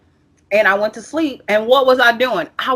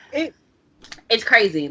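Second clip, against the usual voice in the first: ordinary talk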